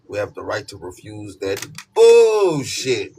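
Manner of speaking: loud, slow, drawn-out declaration; loud slowed-down refusal; emphatic. Real, not acted. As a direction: lean hard into the last word. Emphatic tarot pep talk.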